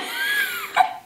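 high squealing laugh. Real, not acted. Laughing after the sour lemon.

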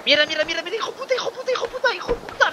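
furious high-pitched cursing; cursing under breath. Real, not acted. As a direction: swear in a rising frenzy. Player killed mid-fight, swearing.

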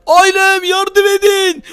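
slow, high, loud plea for help; crying out for help; desperate call. Acted. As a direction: call out to the family for rescue. Calling family for help.

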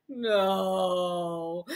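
long, drawn-out groan of dismay. Acted. Mom hearing dinosaurs come with everything, groaning. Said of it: "my mom groaned".

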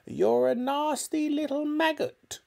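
put-on high, screeched old-woman insult; grandma screeching at George from the other room. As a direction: screech it like a spiteful hag. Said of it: "the voice screeched back".

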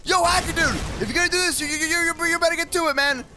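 high-pitched shout for backup; urgent calling out. Acted. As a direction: call out urgently to a distant ally. Calling out to hacker under attack.

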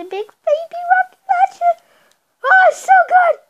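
high, shouted glee; thrilled at the big gun; excited. Real, not acted. Gleeful rocket launcher.

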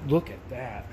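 usual speaking voice

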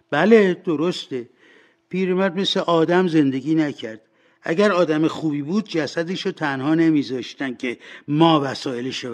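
high, loud blame of the dead man; scornful washerwoman. Acted. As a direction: condemn him loudly, building in force.

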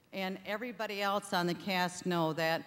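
ordinary talk, nothing marked

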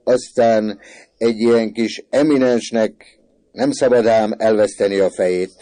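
loud, high, emphatic lecture; hearty pronouncement. A narrator's reading. Father advising the boys about fighting, hearty pronouncement. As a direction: lay down the verdict at full voice.

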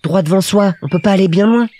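put-on high little-boy voice, a touch melancholy; wistful Petit Prince. A narrator's reading. Little prince reflects on his tiny world, high and slightly melancholy. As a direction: add it wistfully, like a small child. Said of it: "avec un peu de mélancolie".

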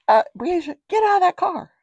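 high, loud acted command; calling out sharply. Acts what grandma should have called.

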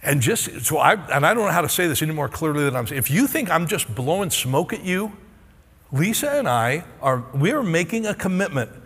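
ordinary delivery

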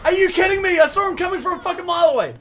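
very loud, high cry of disbelief; real gamer rage, voice shooting way up; outraged yell. Real, not acted. Killed by someone seen coming.

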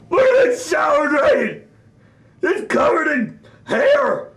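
usual speaking voice

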